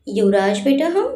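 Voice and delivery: usual speaking voice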